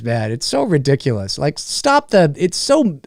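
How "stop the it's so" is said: usual speaking voice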